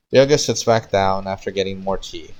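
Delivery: ordinary delivery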